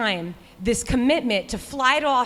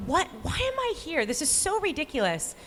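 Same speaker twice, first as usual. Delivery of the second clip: high, softer voice of disbelief; incredulous self-questioning; 7 semitones higher, 4 dB quieter than usual. Retold or imitated. Runner asks herself mid-race why she is here, incredulous. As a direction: retell an exasperated question to yourself, pitched high.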